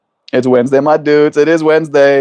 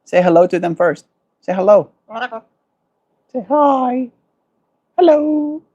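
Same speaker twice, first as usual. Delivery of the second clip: much higher coaxing pet voice; 8 semitones higher than usual. Real, not acted. Teaching bird to say hello.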